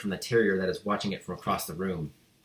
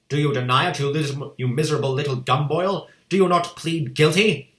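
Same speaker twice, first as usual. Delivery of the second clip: loud, higher accusing demand; angry interrogation; 6 semitones higher, 6 dB louder than usual. A narrator's reading. Headmistress interrogating the boy, loud anger. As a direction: grill him furiously. Trunchbull voiced.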